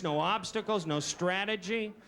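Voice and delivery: usual speaking voice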